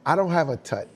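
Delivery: normal talking voice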